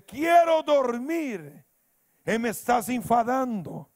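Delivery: drawn-out, high, irritated complaint; angry neighbor. Retold or imitated. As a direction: imitate an annoyed man yelling from his window. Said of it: "Abre la ventana un hombre enojado".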